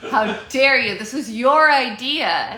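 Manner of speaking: loud, high, hammed-up indignation; mock outrage. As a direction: scold loudly in pretend offence. Mock outrage at forgotten idea.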